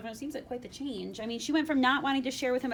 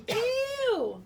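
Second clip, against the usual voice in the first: high, loud disgusted "Ew!"; a hammed-up yuck at the frog; mock disgusted ew; 11 semitones higher, 6 dB louder than usual. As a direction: squeal it like she's grossed out. Acting princess's disgust.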